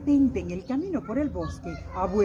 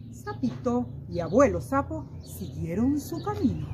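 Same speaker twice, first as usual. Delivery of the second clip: ordinary delivery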